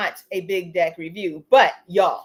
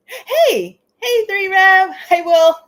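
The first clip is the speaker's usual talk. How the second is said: excited greeting; 9 semitones higher, 9 dB louder, slower than usual. Retold or imitated. Calling out greetings to friends.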